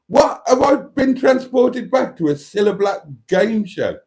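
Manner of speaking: high-pitched mock astonishment; shaken surprise. Retelling reply to the sudden question, hammed-up surprise. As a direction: act out being caught totally off guard. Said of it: "Totally shook me".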